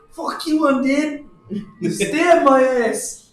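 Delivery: slow, high, loud taunt; hammed-up high battle cry; defiant in-character shout. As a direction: bellow a drawn-out challenge.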